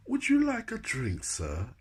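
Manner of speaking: high-pitched posh waiter's offer; lifted, polite posh servant voice; posh butler. Retold or imitated. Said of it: "he talked quite posh".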